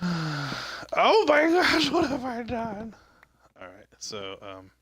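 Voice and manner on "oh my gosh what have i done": very high, drawn-out cry of dismay; mock anguished wail. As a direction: wail it slowly, hammed-up despair. Overdramatic wail at own mistake.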